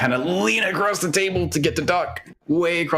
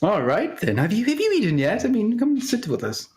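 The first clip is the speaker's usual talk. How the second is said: character voice; 9 semitones higher than usual. Acted. Character greeting guest, high voice.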